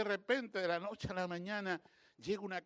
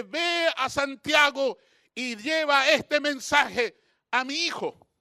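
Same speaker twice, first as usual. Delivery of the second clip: loud, high, slow command; mandato divino; 9 semitones higher, 11 dB louder, much slower than usual. Retold or imitated. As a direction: proclaim it as an order from God. Retold command from the Lord. (orden divina proclamada en voz alta)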